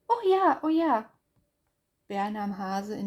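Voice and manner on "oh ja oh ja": high, loud joyful yes; happy cry. Acted. Hase happily agrees to make up, high and loud. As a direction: cheer it up high.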